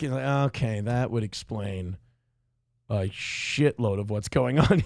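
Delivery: ordinary talk, nothing marked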